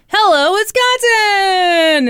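high, loud, long-drawn cheer; mock cheery anchors' shout; group shout. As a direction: shout it like news anchors in a show intro, stretching the end. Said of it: "and they shout".

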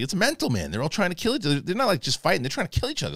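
high-pitched, animated quote; retold amazement. Quoting the actor's amazed account.

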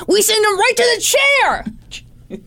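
high, slow mock shout; bad cop threat. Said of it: "bad cop all the way".

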